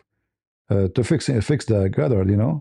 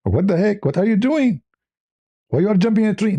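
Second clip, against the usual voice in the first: mock scolding; 7 semitones higher than usual. Retold yelling at the cat.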